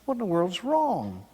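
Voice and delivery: mildly acted alarm; retold shock. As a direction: imitate a mother taken aback. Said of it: "And she was shocked".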